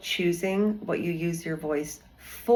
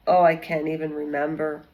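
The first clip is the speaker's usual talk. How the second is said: ordinary talk, nothing marked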